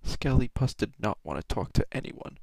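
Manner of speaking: ordinary delivery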